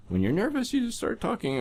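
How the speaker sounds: very high, nervous squeak; exaggerated high voice that isn't your own. Shown as a demonstration. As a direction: shoot the pitch way up as if nervous. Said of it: "in a voice that's not really yours".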